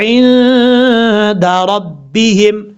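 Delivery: very slow chanted recitation; Quranic recitation. Shown as a demonstration. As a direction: intone it slowly like tajweed. Recitation demo.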